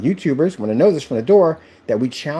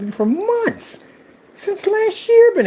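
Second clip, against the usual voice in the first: exasperated; slower than usual. Real, not acted. Exasperated high complaint.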